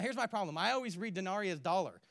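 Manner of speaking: ordinary talk, nothing marked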